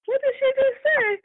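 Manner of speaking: high, startled what-did-I-hear; shocked exec. Retold or imitated. Account exec stunned by the comment in the lobby. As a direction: gasp it like you can't believe your ears. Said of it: "the account exec is like".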